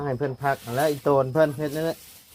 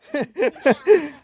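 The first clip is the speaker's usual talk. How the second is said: strained laugh; 14 semitones higher, 4 dB louder than usual. Real, not acted. Laugh during heavy lift.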